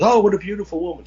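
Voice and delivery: high, quick admiring gush; mock admiration. Man seeing a painting on the wall, mock gushing. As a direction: gush lightly over the portrait.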